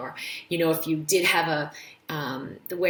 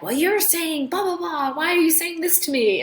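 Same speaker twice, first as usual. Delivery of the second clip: mock argument; 11 semitones higher, 4 dB louder than usual. Acted quarrel with spouse.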